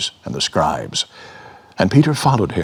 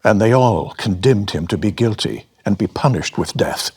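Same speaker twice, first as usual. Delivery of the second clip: usual speaking voice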